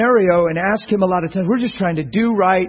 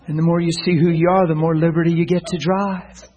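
low, softer, consoling; gentle reassurance; 6 semitones lower, 8 dB quieter than usual. Retold or imitated. Preacher telling crying man he is not an addict, gently reassuring. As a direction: reassure a broken man quietly.

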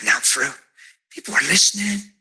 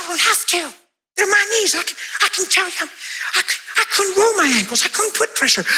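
high excited woman; 8 semitones higher than usual. Retold or imitated. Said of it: "She said".

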